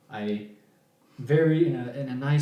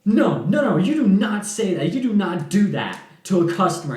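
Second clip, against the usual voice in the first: high, louder scolding; going off; 7 semitones higher, 8 dB louder than usual. Retold or imitated. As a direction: scold him, outraged. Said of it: "she just started going off".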